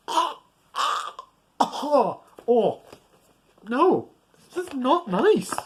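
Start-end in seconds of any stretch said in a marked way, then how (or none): none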